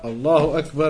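ordinary talk

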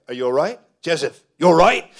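loud, high worried calling. Retold or imitated. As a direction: call out to snap a friend out of it. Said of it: "then he's like".

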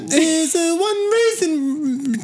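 very high, slow squeaky dog voice. Pretend. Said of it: "Do another dog".

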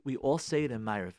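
usual speaking voice

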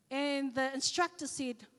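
usual speaking voice

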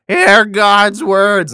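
loud, high and very slow proclamation; mock town crier. Random messenger crying to the streets, mock proclaiming. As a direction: drag it out like a street preacher.

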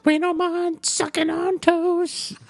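very high, slow squeaky voice. Retold or imitated. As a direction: squeak it out in a silly falsetto. High mock voice, quoted line.

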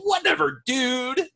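squeaky high-pitched whatever; high catchphrase. Acted. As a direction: pitch it way up and toss it off. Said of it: "I was trying to say it for a long time".

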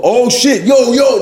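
high-pitched mock bystander hollering; excited onlookers. People come around a street fight, imitated excitement. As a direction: imitate a crowd gathering for a fight. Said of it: "people go".

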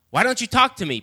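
high, loud demand. Acted. Pilate demanding Jesus speak, high and loud. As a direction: press him hard for an answer. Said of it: "Pilate demanded".

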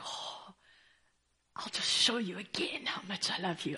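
whispered, loving reassurance; tender whisper. Pretend. God whispering his love to a doubting believer.

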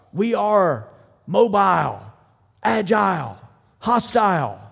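loud, high chant of a team; team's yell. Retold or imitated. Players answering their coach's call, shouted loud. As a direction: shout it back as one squad. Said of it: "they yell out".

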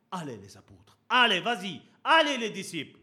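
loud coaxing push; urging on. Retold or imitated. Jesus pushing his disciples to go, loud and urging. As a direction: shove them forward like a coach.

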